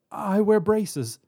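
high, slow, nervous stammer. A narrator's reading. Hostage explains herself to robber, frightened stammer. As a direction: falter anxiously as you explain. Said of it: "she stammered".

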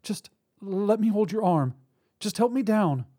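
high, halting request; anxious plea. Acted. Woman on crutches to robber, frightened plea. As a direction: plead for a hand, faltering. Tearful hostage pleading for help.

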